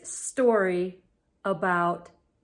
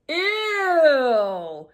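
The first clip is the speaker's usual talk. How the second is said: playful ew; 5 semitones higher, 3 dB louder than usual. Acted. Reacting to fart-filled air.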